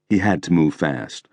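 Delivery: ordinary delivery, nothing marked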